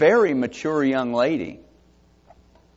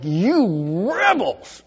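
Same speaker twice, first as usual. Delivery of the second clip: slow, drawn-out mock rebuke; angry rebuke; 3 semitones higher, much slower than usual. Moses scolding the rebellious people, a mild angry rebuke. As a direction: lightly act out his exasperated scolding. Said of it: "He's so angry".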